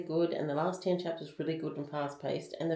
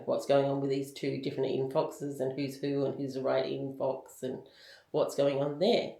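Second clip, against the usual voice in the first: normal talking voice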